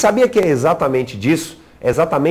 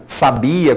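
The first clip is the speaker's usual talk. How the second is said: slow "sabia", stressed sa-BI-a; stress on middle syllable. Shown as a demonstration. Teacher contrasts accent placement, stress on middle syllable. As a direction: lean on the BI in "sabia". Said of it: "aquela sílaba tem mais força do que outras".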